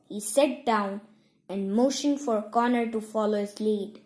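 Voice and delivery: plain reading voice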